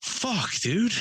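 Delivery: put-on high, exasperated old man; frustrated old man. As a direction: pitch it up in exasperation. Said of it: "he's like".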